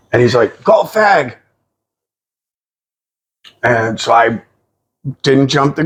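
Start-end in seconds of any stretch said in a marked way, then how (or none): 0.6-1.4 high mock British bloke; put-on British voice at normal pace; British stranger repeating himself. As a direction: imitate the stranger asking in a high voice. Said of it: "Can you say that one more time".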